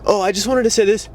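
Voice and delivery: imitating a flustered guy repeating himself; mock weak repeat. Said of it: "so it comes off weaker".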